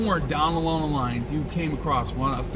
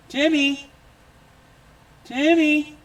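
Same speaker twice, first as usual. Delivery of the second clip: high, louder call of the cat's name; 13 semitones higher, 6 dB louder, slower than usual. Real, not acted. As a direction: call the lost cat loudly. Calling lost cat by name.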